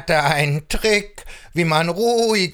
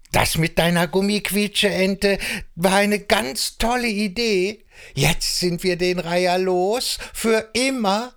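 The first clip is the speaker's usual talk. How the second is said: high, bright little frog voice, thrilled; excited frog; 8 semitones higher than usual. Acted. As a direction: squeak out your thanks like a happy frog. Frog character thanking, excited.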